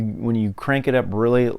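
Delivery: ordinary delivery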